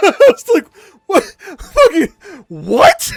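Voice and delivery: cracking up in disbelief, voice climbing; laughing. Real, not acted. Telling a friend about the doctor, laughing helplessly.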